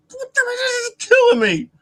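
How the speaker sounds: very high, squeaky, desperate retelling; strained high squeal. Retold or imitated. Officer caught laughing by the owner, strained squeal. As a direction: squeal it as if barely holding it in.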